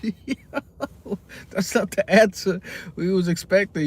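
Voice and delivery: high, loud laughing reaction; laughing disbelief. Real, not acted. Laughing at the outrageous answer.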